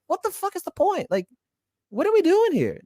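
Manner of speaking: very high, despairing rhetorical questions; exasperated. Real, not acted. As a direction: throw up the question in real exasperation, voice way up. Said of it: "It's just so fucking embarrassing".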